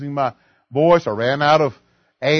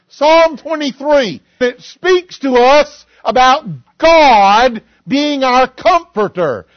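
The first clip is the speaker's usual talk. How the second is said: full-force preaching; 7 semitones higher, 7 dB louder than usual. Acted. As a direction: thunder the verse to the church. Preacher quotes scripture at full force.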